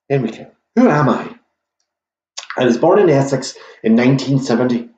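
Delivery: fast, lightly put-on Northern Irish accent; a failed Northern Irish accent, rushed; mock Northern Irish quizmaster accent; bad Northern Irish accent. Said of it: "that's a Northern Irish accent".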